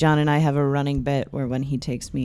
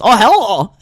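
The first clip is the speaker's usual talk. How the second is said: loud, higher mock Russian hello; shouted Russian hello; 6 semitones higher, 11 dB louder than usual. Her filler, shouted in a Russian accent. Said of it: "I end up shouting that".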